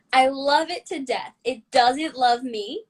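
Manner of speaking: ordinary talk, nothing marked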